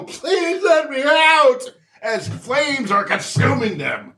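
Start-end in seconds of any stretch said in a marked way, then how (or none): 0.0-1.6 high, slow desperate plea; high slow pleading child; trapped child. Acted. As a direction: beg through the window, panicking. Trapped child in burning house.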